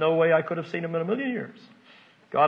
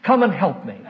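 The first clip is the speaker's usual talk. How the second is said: calling out down the hall; raised voice summoning helpers, easing off by the end; mock yell; 6 semitones higher, 4 dB louder than usual. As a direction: call for help down a corridor. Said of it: "go out in the hall and yell".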